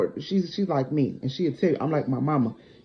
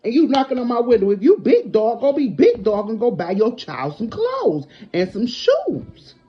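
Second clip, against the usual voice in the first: heated, raised scolding; angry; 6 semitones higher, 5 dB louder than usual. Real, not acted. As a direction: chew him out, voice up high. Telling off the ex.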